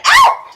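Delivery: very high, loud yelp; short startled cry of pain; sharp yelp of pain. Real, not acted. Dog mouths her when petting hard.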